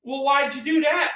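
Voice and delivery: high, indignant retold question; catcher asking why. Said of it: "He goes".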